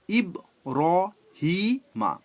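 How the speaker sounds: "ইব র হি মা" with a pause after each syllable; four separate syllables. Shown as a demonstration. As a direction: say "ইব র হি মা" one syllable at a time. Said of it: "চারটে syllable ভাগ করে".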